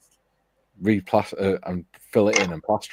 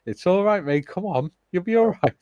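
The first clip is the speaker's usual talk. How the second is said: reassuring; 11 semitones higher than usual. Retold or imitated. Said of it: "I'm like".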